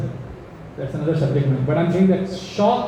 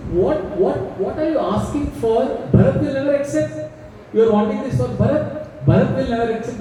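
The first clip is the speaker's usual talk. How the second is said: higher, half-laughing disbelief; incredulous reasoning; 6 semitones higher than usual. Acted. King scoffing at Kaikeyi's demand, raised pitch. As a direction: scoff at the absurd request. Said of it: "he's giving logic now".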